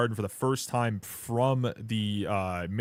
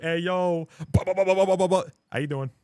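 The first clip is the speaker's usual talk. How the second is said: loud, high, pumped-up hype greeting; hyped air-horn energy; 9 semitones higher, 7 dB louder than usual. Retold or imitated. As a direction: blast it like an air horn going off. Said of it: "That air horn does not fit the energy".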